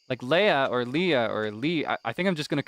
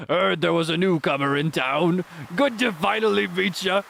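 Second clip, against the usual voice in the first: slow, put-on fisherman accent; Willy Wilson's voice; 3 semitones higher than usual. Fisherman welcoming a newcomer in town, slow put-on accent. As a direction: greet a stranger as an old seafarer. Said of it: "give Willy Wilson's voice".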